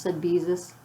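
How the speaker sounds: ordinary delivery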